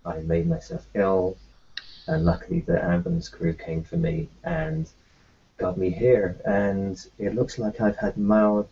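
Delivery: ordinary delivery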